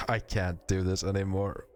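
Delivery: usual speaking voice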